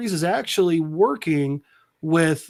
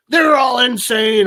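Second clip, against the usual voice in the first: loud, drawn-out incredulous exclamation; mock outrage; 6 semitones higher, 6 dB louder than usual. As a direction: raise pitch and volume like an indignant objector.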